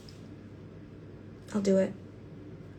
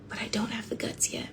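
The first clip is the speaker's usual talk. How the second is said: whisper; 8 dB quieter than usual. Real, not acted. Confiding aside to viewers.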